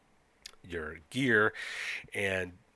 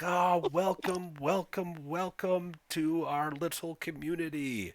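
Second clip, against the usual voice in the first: much higher, drawn-out smug welcome; smug, softly spoken Scandinavian; 9 semitones higher than usual. Acted. Smug Scandinavian leader greets newcomers, soft high-pitched welcome. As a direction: play the nicest, most annoying host, sing-song high. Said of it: "softly spoken, smug, Scandinavian".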